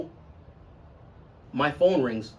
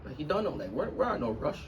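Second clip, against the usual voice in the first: soft, gentle calming; quietly reassuring the girl; 2 semitones higher, 8 dB quieter than usual. Retold or imitated. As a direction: ease her nerves softly. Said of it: "I'm like".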